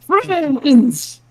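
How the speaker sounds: high, delighted half-orc cry; overjoyed greeting. Acted. Half-orc greeting friends with a giant hug, overjoyed.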